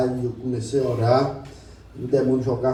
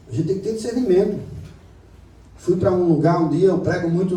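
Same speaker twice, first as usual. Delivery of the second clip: raised, high preaching call; full-force preaching; 4 semitones higher, 4 dB louder than usual. Acted. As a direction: drive the point home to the church. (pregação fervorosa e alta)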